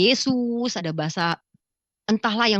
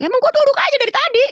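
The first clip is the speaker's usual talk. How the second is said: very high, loud indignant outburst; shrieked retold comeback; exasperated retort; 8 dB louder than usual. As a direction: yell back in annoyance, acting out a quarrel. Snapping back at husband in retold quarrel.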